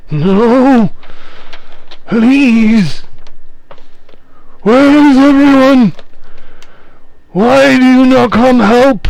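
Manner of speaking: a ghost begging for rescue in panic; desperate spirit pleading. Acted. Spirit begs as it's dragged.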